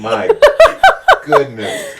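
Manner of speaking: high laughing burst of disbelief; laughing exclamation. Real, not acted. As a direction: laugh out the words in amazement. Reacting to strong cupping pressure.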